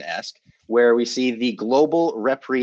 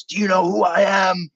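angry shout; 9 semitones higher, 4 dB louder than usual. Retold or imitated. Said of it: "slams this guy against the wall and says".

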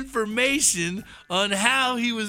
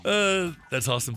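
usual speaking voice

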